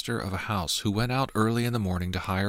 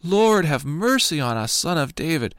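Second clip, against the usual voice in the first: crying out louder; 7 semitones higher, 5 dB louder than usual. A narrator's reading. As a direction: call out over the crowd trying to hush you. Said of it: "they cried out all the more".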